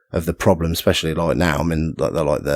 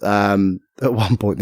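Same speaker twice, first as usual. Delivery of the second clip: normal talking voice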